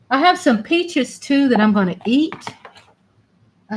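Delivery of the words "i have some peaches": ordinary talk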